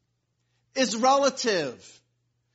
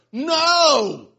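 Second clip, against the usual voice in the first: higher, loud exclaimed refusal; 6 semitones higher, 10 dB louder than usual. Retold or imitated. Preacher acting Naaman's refusal, loud. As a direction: fling out a big refusal.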